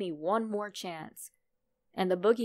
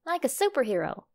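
put-on higher, eager little boy's voice; young son; 6 semitones higher, 5 dB louder, faster than usual. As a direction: play the curious little son. Son asks about the boogeyman.

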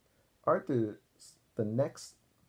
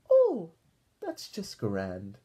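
grandma delighted; 6 semitones higher, 5 dB louder, much slower than usual. Acted. Grandma Thora pleased Arthur is well, high then slow. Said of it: "said Grandma Thora".

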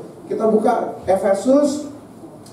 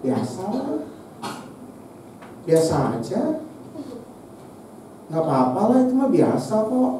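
dismissive shrug; 7 dB quieter than usual. Retold or imitated. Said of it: "jadi kita bisa ngomong begini".

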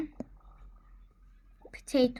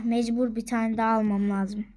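ordinary talk, nothing marked

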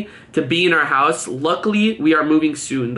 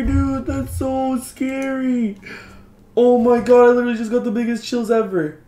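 high-pitched frightened wail easing off; real scared, whiny high voice after a fright; freaked out; 7 semitones higher than usual. Real, not acted.

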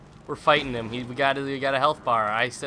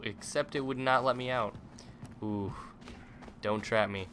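normal talking voice

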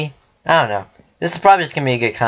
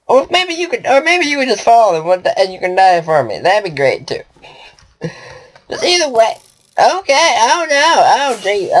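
high, frantic pleading in panic; panicked high pleading; 7 semitones higher than usual. Real, not acted. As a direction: beg the enemies in a panic.

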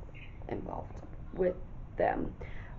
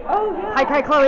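high, loud squealed hello; excited greeting; 9 semitones higher, 12 dB louder, slower than usual. Real, not acted. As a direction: greet her like you're thrilled to see her.

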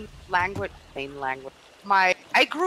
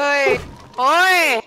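loud calling out, pitched up; sharp playful shout across the game; 5 semitones higher, 9 dB louder than usual. Real, not acted. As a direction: yell to grab a friend's attention. Calling out to a friend.

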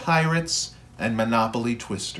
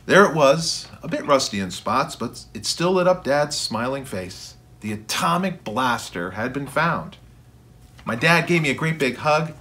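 ordinary delivery

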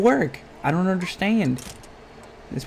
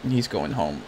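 He's going home, dropped low and soft; low mocking echo; 8 semitones lower, 7 dB quieter than usual. Real, not acted. Gamer mocking his character Sarge, low soft echo. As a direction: mutter it back in disbelief. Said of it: "mocking echo".

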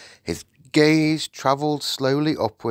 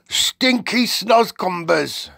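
high, loud, slow giant voice; emphatic giant; 11 semitones higher, 5 dB louder, slower than usual. Acted. BFG repeats himself to the Queen, slow and loud. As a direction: spell out the odd word with relish.

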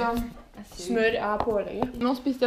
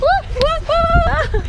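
excited whoop, much higher and louder; loud high cheer; 10 dB louder than usual. Real, not acted. Arriving at the colourful park, whooping. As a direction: let out a big thrilled woo.

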